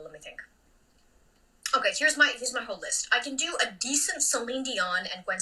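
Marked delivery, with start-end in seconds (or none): 0.0-0.4 very soft, low near-whisper; hushed aside; thinking aloud. Real, not acted. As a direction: mumble it to yourself while thinking. Muttering while thinking.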